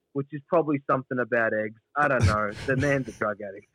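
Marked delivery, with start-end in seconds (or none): none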